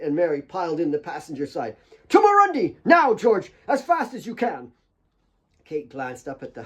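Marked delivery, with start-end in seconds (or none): 2.1-4.7 high loud orders, hurried; loud urgent shout; urgent command. A narrator's reading. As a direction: bark the orders at George. Mary urging George to drive.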